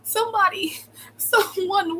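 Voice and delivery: high-pitched cry for help; acted-out scream for rescue; retold scream for help. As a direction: call out for somebody, high and urgent. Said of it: "I'm screaming".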